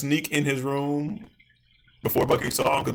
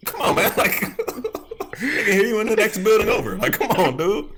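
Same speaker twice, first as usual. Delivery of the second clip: loud, higher, laughing exasperation; mock exasperation; 7 semitones higher, 4 dB louder than usual. Viewer urging the man to unlock it, exasperated and laughing. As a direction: plead with him while cracking up. Acted pleading with the noisy character.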